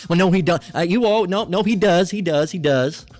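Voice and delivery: acted heated argument. Child contradicting mama about [name], acted heated insistence. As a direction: insist over the other person, mock quarrel.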